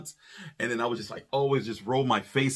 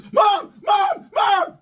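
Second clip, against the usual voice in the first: high, loud shout of Mom; calling out; 7 dB louder, much slower than usual. Retold or imitated. Husband bursting in, calling loudly for his wife. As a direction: yell it as you rush in.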